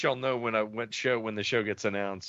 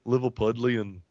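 Liverpool accent; 5 dB louder than usual. Shown as a demonstration. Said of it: "where are the Beatles from?".

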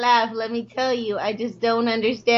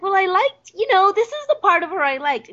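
lightly acted, higher-pitched imagined quote; a hint of someone else's voice, higher; imagined fan; 9 semitones higher than usual. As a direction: quote a hypothetical viewer in a lifted pitch. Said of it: "where you're like".

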